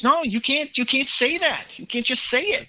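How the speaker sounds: imitating an exasperated interrogator; insistent objection. Acted quarrel, senator cutting off witness.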